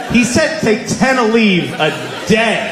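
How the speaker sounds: high, drawn-out disbelief, strongly punched; incredulous. Retold or imitated. Comedian repeating doctor's dosage advice to crowd, incredulous. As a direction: exclaim it slowly in comic outrage.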